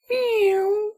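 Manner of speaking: high sweet kitten mew; kitten meow. Acted. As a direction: meow like a tiny cat. Kitten meows at Brent.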